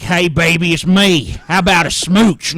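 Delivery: hammed-up, loud suitor impression; Galen. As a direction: impersonate a pal making a pushy flirt. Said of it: "I do the Galen voice".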